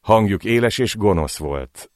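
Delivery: usual speaking voice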